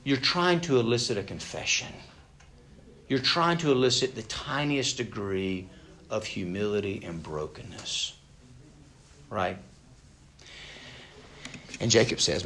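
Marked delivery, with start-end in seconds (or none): none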